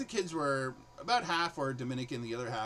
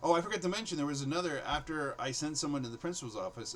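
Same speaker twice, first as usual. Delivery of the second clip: ordinary talk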